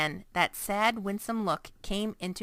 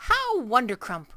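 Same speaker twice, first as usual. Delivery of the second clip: louder, delighted exclamation; beaming cry; 4 semitones higher, 7 dB louder than usual. A narrator's reading. Giant beaming at Sophie's praise, crying out. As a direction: burst with joy. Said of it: "cried the B F G, still beaming".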